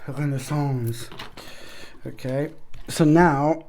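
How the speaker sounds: ordinary delivery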